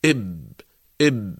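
"إب" ending where the lips close; consonant with sukoon. Shown as a demonstration. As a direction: halt "إب" firmly on the b. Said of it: "عند انطباق الشفتين يتوقف الصوت". (نطق "إب" بتوقف الصوت عند انطباق الشفتين)

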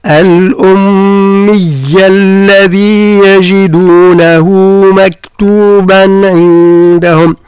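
slow, drawn-out tajweed chant; Quran recitation. Shown as a demonstration. Teacher reciting a verse to his student. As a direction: chant the verse slowly, each letter given its due.

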